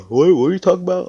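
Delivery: high, loud words swallowed by a yawn; talking through a yawn. Acted. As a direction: speak while still yawning hard. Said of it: "I was yawning".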